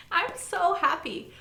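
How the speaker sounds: high, slow, drawn-out gushing; overjoyed. Real, not acted. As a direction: stretch it out with giddy joy.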